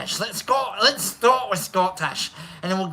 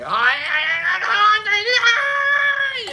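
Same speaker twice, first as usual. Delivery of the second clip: mock cheer; 11 semitones higher, faster than usual. Mocking Pops' boast.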